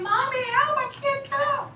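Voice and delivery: very high-pitched wail for mommy; mock crying child. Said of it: "I'm crying, and I'm saying".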